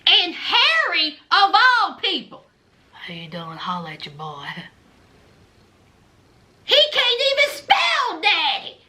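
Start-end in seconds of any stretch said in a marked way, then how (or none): 2.9-4.5 very low, barely audible mumble; Harry's mumble. Acted. Host mocking an inmate's greeting, mumbled. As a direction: mumble it under your breath, far softer and lower. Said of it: "who mumbles throughout life".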